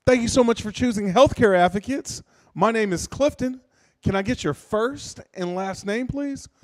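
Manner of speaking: high, perky phone greeting; customer service rep. Acted. Acted call-center greeting to customer.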